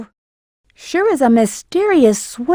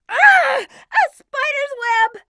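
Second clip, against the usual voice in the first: high yelp, then the words called out slowly; high startled cry at a web; startled shout; 10 semitones higher, slower than usual. Acted. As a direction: jump back at the web, calling out.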